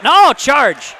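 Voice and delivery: loud, high burst of surprise; excited foul call. Real, not acted. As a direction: call the sudden charge with a real jolt. Sudden charge call in live play-by-play.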